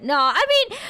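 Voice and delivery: ordinary delivery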